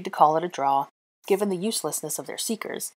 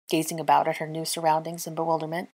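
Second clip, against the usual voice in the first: plain reading voice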